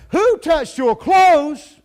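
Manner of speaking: hammered-out preaching shout; shouted question. Acted. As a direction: boom the question out to the whole crowd. Preacher repeats Jesus' question.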